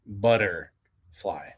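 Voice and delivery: "butterfly" slow, the T's turned into a D, American style; butterfly with D's. Shown as a demonstration. Host explaining American pronunciation, "butterfly" slowly with D's. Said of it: "literally with D's".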